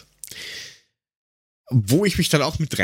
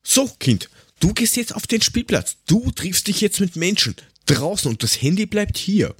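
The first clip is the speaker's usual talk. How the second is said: a hammed-up strict parent, pitched higher; 8 semitones higher than usual. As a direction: lay down the law to the kid, mock-stern. Parent laying down the law to child. (als strenger Elternteil gespielt, höher)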